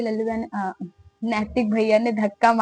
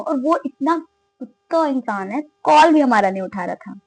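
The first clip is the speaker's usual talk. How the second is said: ordinary delivery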